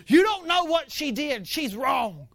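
high, loud indignant outburst; worked-up complaining husband; indignant protest. Acted. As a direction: protest loudly like an aggrieved husband.

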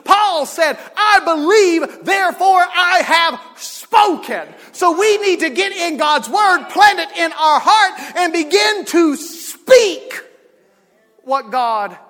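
loud, high, slow proclaiming; rising preaching. Real, not acted. The preacher quoting Paul to the church, loud. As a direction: build it up and preach.

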